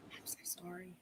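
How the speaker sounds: hushed, choked whisper; barely there, moved; whispered condolence. Real, not acted. Medium whispers sympathy to the grieving sister. Sitter reveals brother's death.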